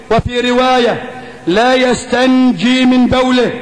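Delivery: ordinary talk, nothing marked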